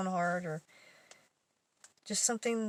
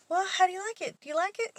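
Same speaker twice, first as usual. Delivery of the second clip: much higher, louder faux-sweet question; mock innocent; 11 semitones higher, 3 dB louder, faster than usual. Teller presents ugly gift to coworker, mock innocent high voice. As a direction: fish for praise with a straight face.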